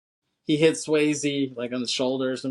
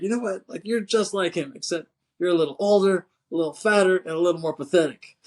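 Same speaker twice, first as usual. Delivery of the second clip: higher and louder, a hammed-up chewing-out; mock angry boss rant; 6 semitones higher, 4 dB louder, faster than usual. Retelling the angry chief berating the agent, hammed-up rant. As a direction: play the furious chief, mocking. Voicing McGinley chewing Utah out.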